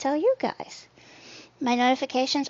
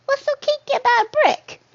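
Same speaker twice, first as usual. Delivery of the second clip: very high incredulous squeal; high-pitched comic bewilderment; squeaky disbelief; faster than usual. Pretend. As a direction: squeak the question, baffled. Baffled by a chat comment.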